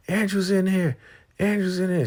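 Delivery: high, loud shriek; screaming. Retold or imitated. Lynn screaming out that Andrew is inside, loud shriek. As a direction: scream to give him away. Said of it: "She started yelling and banging".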